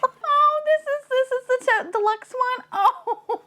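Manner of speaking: high, thrilled gushing; excited high squeal. Real, not acted. She unboxes the deluxe figure, squealing high with joy. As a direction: gush as if you won.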